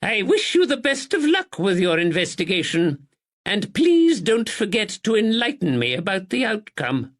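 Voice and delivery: put-on high, stately old lady; elderly lady voice. Narrator voices Lady Anne.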